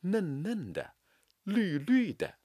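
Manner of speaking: 嫩嫩的 绿绿的 said softly; touch them lightly; soft stress. Shown as a demonstration. Teacher quoting the poem softly. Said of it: "同样采用轻吐的手法".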